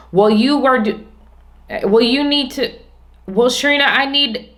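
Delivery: high, rushed, demanding mimicry of coworkers; pushy coworker demands. Coworkers pestering Sharina at the door, imitated high and demanding. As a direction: imitate them firing requests at her the moment she walks in.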